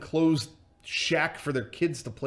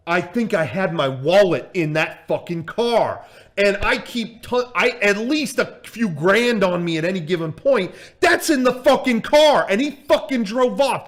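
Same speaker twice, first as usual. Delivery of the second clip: loud, high, enraged tirade; furious rant; 8 semitones higher, 9 dB louder than usual. Retold or imitated. Boxing promoter whose wallet left in the car, furious rant. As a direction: build to an outraged yell. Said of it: "I'm fucking furious about this".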